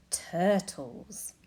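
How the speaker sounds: ordinary delivery, nothing marked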